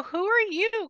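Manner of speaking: high surprised question. Retold or imitated. Medium asking the spirit who it is, high and surprised. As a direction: ask it high and startled. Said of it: "I go".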